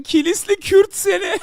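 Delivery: high-pitched cackling laugh breaking through the words; cracking up while reading a chat message; laughing hard. Real, not acted. Streamer reading a chat insult, laughing hard.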